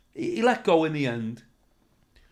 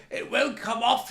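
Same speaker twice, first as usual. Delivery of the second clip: teasing heckler; 6 semitones higher, slower than usual. Retold or imitated. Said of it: "this other one said".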